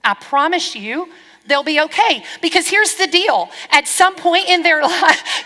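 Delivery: ordinary delivery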